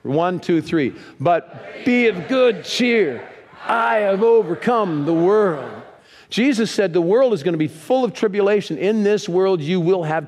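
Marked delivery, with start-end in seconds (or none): 1.2-5.7 mighty group reading. Real, not acted. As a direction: call the verse out big and slow. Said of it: "Are you ready for this?".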